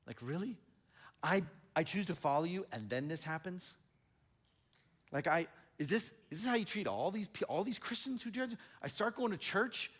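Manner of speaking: indignant protest. Retold or imitated. Acting out past complaint to God.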